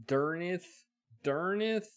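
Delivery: loud, high puzzled repeat; real disbelief at the name; baffled. Real, not acted. Player baffled by the name on screen, loud and puzzled.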